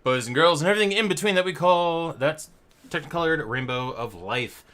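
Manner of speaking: loud, high showman greeting; announcer welcome. Acted. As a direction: open the show like a game host. Host opens trivia night.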